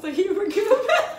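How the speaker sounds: loud, giggly laughing speech. Real, not acted. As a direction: laugh through the words. Laughing about refunds joke.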